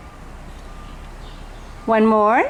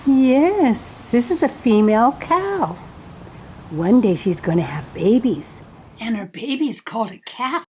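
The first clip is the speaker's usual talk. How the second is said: put-on reading to a toddler; 3 semitones lower, 4 dB quieter than usual. Talking to children like toddler.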